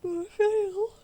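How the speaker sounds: words stretched by a yawn; talking through a big yawn. Real, not acted. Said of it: "je baille".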